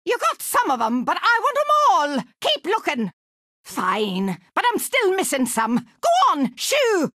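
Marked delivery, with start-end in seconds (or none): 6.0-7.1 high-pitched, brusque dismissal; impatient shooing. Acted. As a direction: shoo them off to work. Shooing the player away.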